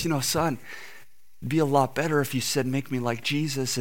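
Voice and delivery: lightly voiced pastor, quietly correcting; gentle pastor. Pastor leans down to the crying man, gently counselling.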